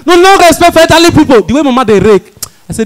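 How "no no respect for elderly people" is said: high, loud mock protest; mock outrage. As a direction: shout it like a scandalised passenger defending an elder. Protesting on mama's behalf.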